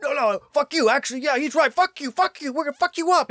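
very high, loud mock taunting; cartoonish high-pitched belligerent yelling; hammed-up aggressor. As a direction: pick a fight in a squeaky frenzy. Acting out US picking a side.

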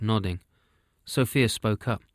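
ordinary delivery

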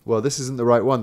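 plain reading voice

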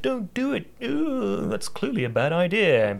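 high anxious little cartoon voice; high worried voice. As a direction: squeak out the warning. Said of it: "Mugman's like".

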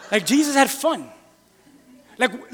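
ordinary talk, nothing marked

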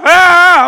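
high, loud startled ah; retold scream. Driver yelping as truck dies on highway. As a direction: yelp in sudden fright.

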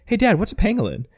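lightly voiced young son; kid voice. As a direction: pipe up like a curious boy. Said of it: "the kid of the dad is like".

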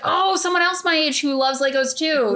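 high-pitched imitation of delight; excited coworker. Relaying a coworker's joy at a fellow Lego fan, imitated excitement. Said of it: "she was really excited".

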